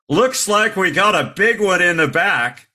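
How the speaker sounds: imitating a flight attendant announcing down the cabin; mock announcement for everyone to hear; calling out.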